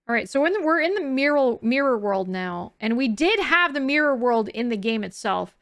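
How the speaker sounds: ordinary delivery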